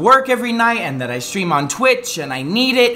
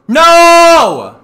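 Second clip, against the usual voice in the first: a high, loud, drawn-out cry of no; anguished shout; 10 semitones higher, 11 dB louder than usual. Retold or imitated. Streamer at the shop counter, howling no at the injustice. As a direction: howl it at the injustice. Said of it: "look at them and go".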